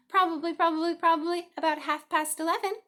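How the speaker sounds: high, loud, fussy goose voice, repeating herself; a busy mother goose clucking the time; chattering goose. A narrator's reading.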